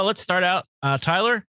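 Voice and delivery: ordinary talk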